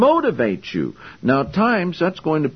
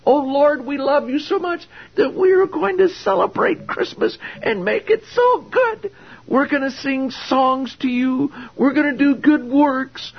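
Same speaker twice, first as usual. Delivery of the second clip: much higher, simpering falsetto parody; mock falsetto prayer; 13 semitones higher than usual. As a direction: mimic a gushing worshipper in falsetto. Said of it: "walk up to God and say".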